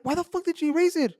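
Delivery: high, incredulous demand; retold protest. Telling how he asked his wife about the erased picture, incredulous. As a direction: act out exasperated disbelief, pitch shooting up.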